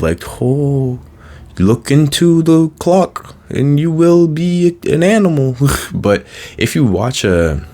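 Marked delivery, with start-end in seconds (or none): 0.4-5.6 high, loud, hammed-up hypnotist voice with a strange timbre; mock stage hypnotist. Mocking a cheesy stage hypnotist, much higher and louder. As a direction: lure them into the clock like a cheesy showman. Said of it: "I think hypnosis, I'm not saying, like, the kind of".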